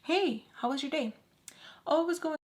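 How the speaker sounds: high, perky back-and-forth chat; acted small talk. Shown as a demonstration. Showing listeners how it was when they were talking, perky small talk. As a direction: act out a chirpy exchange. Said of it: "I'm gonna show you example".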